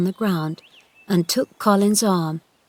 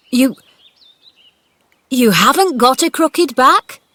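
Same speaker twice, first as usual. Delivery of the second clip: put-on loud, high old-man question; astonished old gardener; 6 semitones higher, 7 dB louder than usual. A narrator's reading. Gardener asking the boy in disbelief. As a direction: ask in disbelief.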